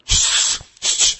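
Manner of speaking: papa's acted shushing; urgent hushing. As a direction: hush the crying child. Embarrassed papa hushing the child.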